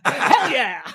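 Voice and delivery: high mock cheer through laughter; hammed-up high, laughing enthusiasm; converted crowd member. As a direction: cheer along, laughing at yourself. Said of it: "by day four, I'm like".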